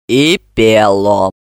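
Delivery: low, slow musing; drawn-out, pondering low voice; thoughtful. A narrator's reading. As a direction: add it pensively. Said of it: "задумчиво добавила Бессарабова".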